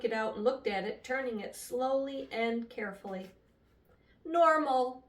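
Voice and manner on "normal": announced a bit higher and louder; announcing. Acted. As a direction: declare the reading proudly. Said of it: "she announced".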